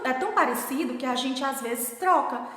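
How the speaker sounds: usual speaking voice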